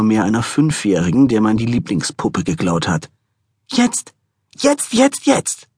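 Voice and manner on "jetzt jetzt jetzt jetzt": very high shrieking nag; shrill nagging. A narrator's reading. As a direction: shriek it like a tantrum child. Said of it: "Ihre keifende Stimme".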